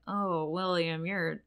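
very slow, drawn-out mock lament; deadpan kids. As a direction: drag it out like a dull chorus. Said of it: "the kids have to be like".

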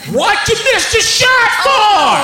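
laughing parody of a yelling sideline parent; screaming sports dad. Retold or imitated. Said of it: "who was screaming his stupid ass".

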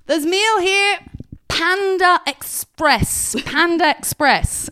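high, slow, over-enunciated British wonder; imitating Chris's British accent. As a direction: say it like an archaeologist unearthing something. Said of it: "he's saying it like he's an archaeologist".

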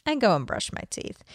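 ordinary talk, nothing marked

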